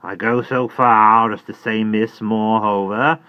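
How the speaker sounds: slow, loud pulpit tone; Jerry sermonizing to Miss Pross, slow and loud; preachy Jerry. Acted. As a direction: hold forth like a preacher. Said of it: "hold forth as from a pulpit".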